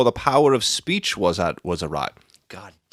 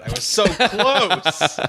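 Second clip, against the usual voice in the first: laughing; 8 semitones higher, slower than usual. Real, not acted. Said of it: "laughing frustration".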